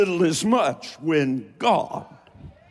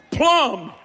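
Appalachian word, high; 7 semitones higher than usual. Shown as a demonstration. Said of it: "Plum means all the way".

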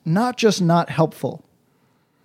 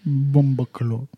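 put-on Jamaican accent; 5 semitones lower, slower than usual. Low slow patois.

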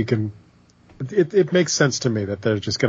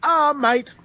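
mock British Becky; 6 semitones higher, slower than usual. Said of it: "Becky gets a mic and goes".

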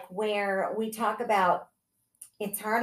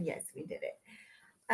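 soft, slow whisper; hushed whispered agreement; 3 semitones lower, 12 dB quieter than usual. Real, not acted. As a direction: breathe it out slowly. Quiet joke after break.

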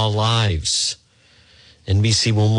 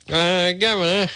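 mocking tone; 9 semitones higher than usual. Running mocking gag on governor.

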